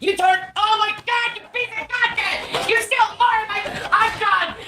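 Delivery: high-pitched furious yelling; screaming. Real, not acted. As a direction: scream at the roommate. Screaming mid-fight.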